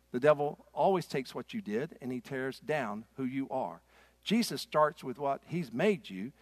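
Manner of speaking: ordinary talk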